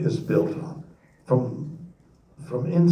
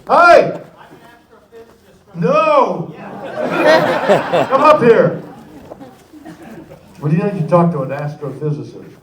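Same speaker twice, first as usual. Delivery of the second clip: calling out; 5 semitones higher, 6 dB louder than usual. Real, not acted. Calling to audience member.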